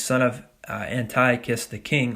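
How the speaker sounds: normal talking voice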